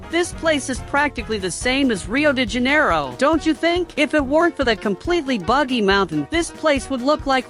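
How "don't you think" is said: normal talking voice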